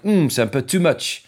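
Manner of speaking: French sentence dropping in English "too much"; French speaker. Retold or imitated. A French person mid-conversation throwing in English, imitated French accent. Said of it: "when the French are talking".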